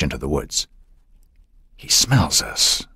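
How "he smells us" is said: slow hushed whisper; soft whisper. A narrator's reading. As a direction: breathe it so the deer can't hear. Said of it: "Bill said softly".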